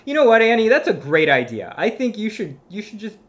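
hammed-up encouraging host; mock host reply. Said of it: "So I'd be like".

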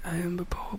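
faint mock pope whisper; too weak to speak. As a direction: barely get it out, hammed-up. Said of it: "The pope is too weak to speak".

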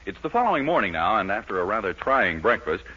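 ordinary talk, nothing marked

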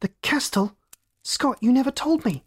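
high-pitched, horrified protest; blanching shock. A narrator's reading. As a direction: protest as the dread sinks in. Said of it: "Max's face blanched".

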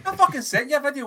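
insistent repeat. Real, not acted. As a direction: say it again so they finally hear. Repeats it over the others.

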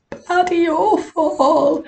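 AWFUL stretched out, high; emphatic misery. Real, not acted. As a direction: draw out "awful" in a high wail. Recalls her worst failed demonstration.

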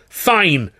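loud, high and curt; shout. Acted. Actor shouting in his boss's face. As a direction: snap it back. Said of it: "I shouted".

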